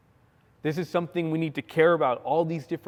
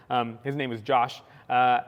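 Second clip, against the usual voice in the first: ordinary talk, nothing marked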